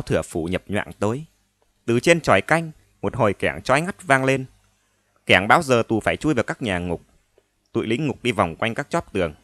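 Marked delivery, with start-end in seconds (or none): none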